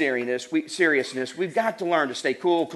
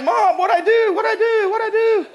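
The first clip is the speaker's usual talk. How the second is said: put-on high, loud pleading cries of a spanked boy; kid wailing; 7 dB louder than usual. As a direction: beg like a kid being punished. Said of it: "getting the life beat out of him".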